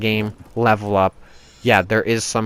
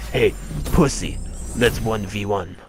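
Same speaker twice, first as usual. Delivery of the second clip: mock taunting challenge. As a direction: imitate an online trash-talker. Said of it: "and not be like".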